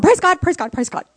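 high, loud burst of retold rejoicing; jubilant praise. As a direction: burst out praising in quick repeats. Reliving joy at mother's healing.

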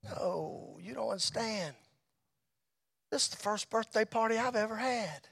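very soft, choked-up imitation; hushed, as if holding back tears; retold tearful speech. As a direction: imitate a friend quietly tearing up. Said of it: "he's kinda sobbing and tearing up".

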